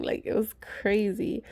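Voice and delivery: slow awed whisper; hushed amazement. Real, not acted. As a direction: whisper in disbelief.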